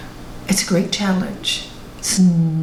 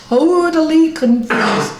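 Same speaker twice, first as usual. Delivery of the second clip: "totally" pitched high and stretched out; emphatic TOTALLY; 7 semitones higher, 4 dB louder than usual. A narrator's reading. Telling how the third disciple got confused, "totally" high and emphatic.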